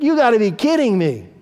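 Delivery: incredulous retort. Retold or imitated. Husband scoffing at his wife's trip plan, incredulous. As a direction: toss it back like an annoyed husband. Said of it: "I was like".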